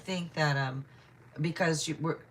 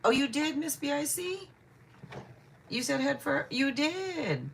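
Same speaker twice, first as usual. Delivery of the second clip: surprised realization; 10 semitones higher than usual. Real, not acted. Realizing a viewer answered first.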